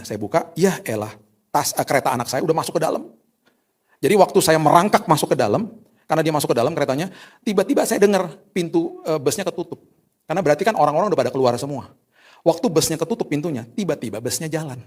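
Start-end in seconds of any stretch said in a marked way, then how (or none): none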